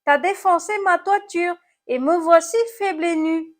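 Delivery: high, loud snail lament; moaning complaint. A narrator's reading. Snail blames apple for its smashed roof, moaning. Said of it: "gémit l'animal cornu".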